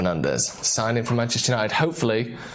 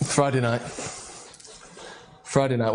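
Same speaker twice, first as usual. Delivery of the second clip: ordinary delivery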